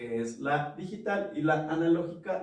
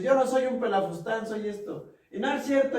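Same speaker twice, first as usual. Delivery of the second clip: rising, louder, indignant denial; mock heated denial; 8 semitones higher, 4 dB louder than usual. Shown as a demonstration. Arguing partner snapping back in a mock fight, indignant. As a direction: argue back, heating up. Acted quarrel escalating.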